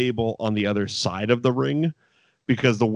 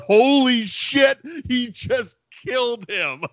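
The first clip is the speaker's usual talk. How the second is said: thrilled disbelief; 13 semitones higher, slower than usual. Retold or imitated. Viewer dances around after the put-down, retold high gleeful astonishment. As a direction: crow it in astonished glee, climbing higher. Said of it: "stand up from your chair".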